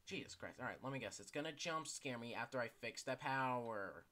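ordinary talk

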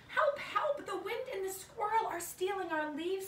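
mock panic, calling out help; cry for help. Little bear calling out to the forest, mock panicked help.

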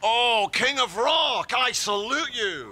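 high, slow, grand hailing; mock homage. Parent to child offering air guitar, grandly hailing. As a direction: salute a rock god with drawn-out pomp.

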